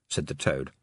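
plain reading voice

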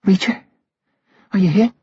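high breathy woman's voice. A narrator's reading. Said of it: "A breathy voice came back in the dark".

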